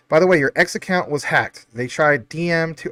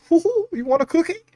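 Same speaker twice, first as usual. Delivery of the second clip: mocking voice; 13 semitones higher than usual. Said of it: "is gonna be like".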